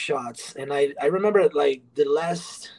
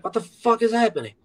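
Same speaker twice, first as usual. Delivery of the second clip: pitched-up bewildered outburst; shocked disbelief; 8 semitones higher, faster than usual. Retold or imitated. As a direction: blurt it out, just waking from a blackout. Waking up confused in manager office.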